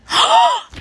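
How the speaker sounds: sharp, high, loud gasp; shocked gasp. Real, not acted. Player finding out they are it, shocked gasp.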